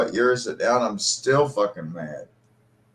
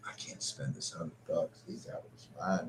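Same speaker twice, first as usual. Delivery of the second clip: low, soft stunned mutter under the breath; talking to himself in disbelief; 3 semitones lower, 14 dB quieter than usual. Retold or imitated. Son leaving his father's house, stunned, quiet muttering. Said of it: "I'm like".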